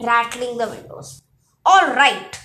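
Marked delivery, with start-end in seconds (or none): 1.7-2.3 loud shout up at the thunder. A narrator's reading. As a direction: yell it skyward, giving in. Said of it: "Chiron shouted".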